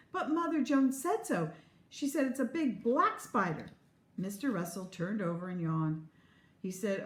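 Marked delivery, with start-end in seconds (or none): 0.1-3.7 squealing. Acted. Girl protests to her mother, high squealing. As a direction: squeal it out, all excitement.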